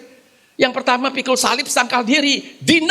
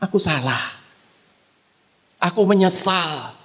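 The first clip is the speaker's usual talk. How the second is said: remorseful Job; 10 semitones lower than usual. Retold or imitated. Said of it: "Dia bilang".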